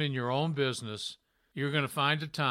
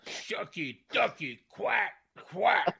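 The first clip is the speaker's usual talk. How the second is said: slow, drawn-out catchphrase; a light take on Booker T; Booker T impression; 4 semitones higher, slower than usual. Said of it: "Booker T imitation".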